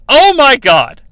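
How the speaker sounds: loud, high, put-on horror; mock dismay. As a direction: gasp it out in mock disbelief.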